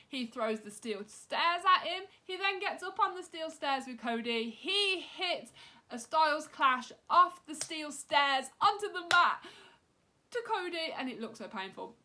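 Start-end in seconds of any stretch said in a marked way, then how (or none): 0.1-10.9 climbing higher and louder with each move; building excitement. Real, not acted. Vlogger recounting the steel-stairs Styles Clash, rising excitement. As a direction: get more worked up blow by blow. Recapping AJ's brutal attack.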